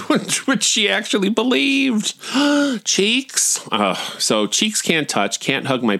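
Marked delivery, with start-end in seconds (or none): 0.1-2.2 high-pitched disbelief; incredulous, voice shooting up high. Real, not acted. Amazed that mom believed her. 2.3-3.6 high gasp, then a squeaked word; hammed-up shocked squeak; mock horror. Mocking mom's horror at cheeks.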